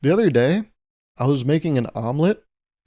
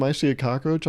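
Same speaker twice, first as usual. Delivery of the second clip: ordinary talk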